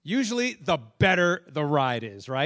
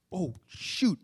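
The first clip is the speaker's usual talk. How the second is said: whisper. Retold or imitated. Remembering a forgotten prayer.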